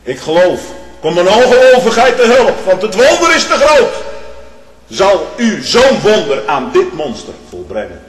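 high, loud cry of anguish that climbs; anguished father crying to the Lord; desperate father's cry. Acted. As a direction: cry out like a father begging for his son. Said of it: "riep die man uit".

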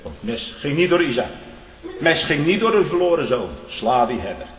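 preacher thundering the sword against the shepherd; preaching at full force. Acted. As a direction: build to a ringing pulpit shout.

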